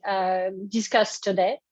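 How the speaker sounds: ordinary delivery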